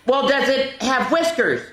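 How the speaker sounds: put-on high, bright child's question; small excited voice asking a guess; high little voice. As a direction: ask it like an eager little donkey.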